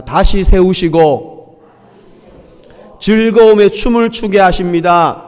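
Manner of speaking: slow, every word drawn out for the church to repeat; slow lead line. Shown as a demonstration. Pastor leads the congregation in repeating, slow and clear. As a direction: say it slowly so they can follow. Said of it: "한번 저를 따라 하겠습니다".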